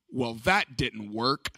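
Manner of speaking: put-on slow, low deadpan little kid; mock serious. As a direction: deliver it slowly, like a solemn verdict. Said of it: "like he's about to say something real serious".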